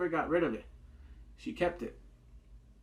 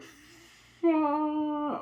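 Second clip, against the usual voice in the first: shocked curse; 12 semitones higher, 5 dB louder than usual. Retold or imitated. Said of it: "I was like".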